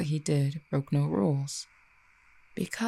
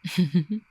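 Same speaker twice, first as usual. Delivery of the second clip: short, louder chuckle; amused chuckle; 3 semitones higher, 4 dB louder than usual. Acted. Cupid reacting to the listener, amused chuckle. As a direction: let out a brief amused laugh.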